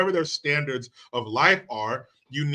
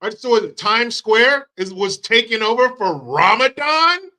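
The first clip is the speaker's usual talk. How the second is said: normal talking voice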